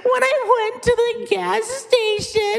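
put-on very high, slow, whiny weepy child; high crying child voice; sad kid voice. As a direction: whimper it like a sulking kid. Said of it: "I could also sound really sad".

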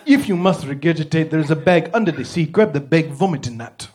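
put-on formal African-accented cab driver. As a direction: give the big word a comic African accent. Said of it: "if you say a big word in a funny accent".

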